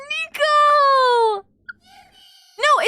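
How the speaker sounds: long, high, loud drawn-out cry; anguished wail. Real, not acted. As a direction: wail the name slowly, almost crying. Wailing the blocked viewer's name.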